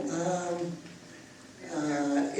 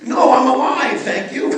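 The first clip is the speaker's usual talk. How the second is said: mock loud protest; 6 semitones higher, 11 dB louder than usual. Joking reply after yawn.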